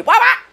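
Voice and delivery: high, loud fake wail; mock crying. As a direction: cry like a whiny baby. Mocking the crying man.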